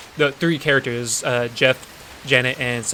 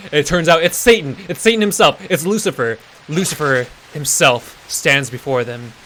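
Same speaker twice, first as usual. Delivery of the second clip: ordinary talk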